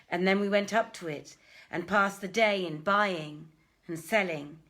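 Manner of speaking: dramatic story reading. Acted. Reading the Arabian Nights to a lockdown audience, loud declaiming narration. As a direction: read the tale out grandly to listeners.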